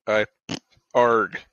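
ordinary talk

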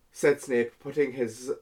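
ordinary delivery

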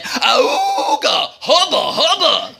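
cartoon catcall, pitch jumping on each hubba; hammed-up wolf-whistle style catcall; leering king's men. A narrator's reading. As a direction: hoot like a crowd of ogling men. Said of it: "all the king's men went".

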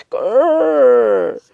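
loud, high-pitched creaking door imitation. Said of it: "that's the door noise".

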